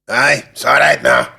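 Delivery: loud, slow mock Scottish call; attempted Scottish accent. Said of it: "attempt at a Scottish accent".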